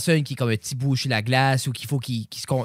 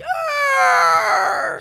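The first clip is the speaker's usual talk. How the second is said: sudden high shout; short shrieking cry; playful scream; 7 dB louder than usual. Retold or imitated. Imitating the kid's yell.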